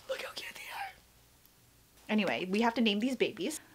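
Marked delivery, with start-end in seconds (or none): 0.0-0.9 giggly high whisper over something cute; hushed, giggling whisper of delight; excited whisper. Real, not acted.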